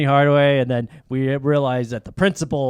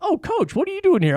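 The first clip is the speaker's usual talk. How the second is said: much higher, surprised greeting; high mock Larry Bird. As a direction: squeak it up like a startled Larry. Larry Bird voiced greeting Coach.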